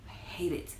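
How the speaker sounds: whispered confession. Pretend. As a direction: breathe it like a secret. Mock confession about food.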